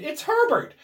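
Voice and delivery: high, loud repeated scream. A narrator's reading. Wife sure her son is at the door, screaming. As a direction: scream it at the top of the voice. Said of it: "she screamed".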